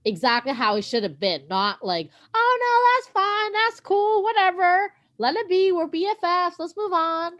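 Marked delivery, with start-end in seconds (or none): 2.3-7.3 high, singsong fake-nice voice; mock breezy forgiveness. Host parodying unrealistic emotional scenes, mock breezy forgiveness. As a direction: brush it all off in a much higher parody voice. Mocking falsely easygoing show dialogue.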